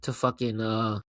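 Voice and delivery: usual speaking voice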